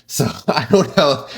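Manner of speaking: laughing through the words. Real, not acted. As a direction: giggle it out, high. Laughs while saying it.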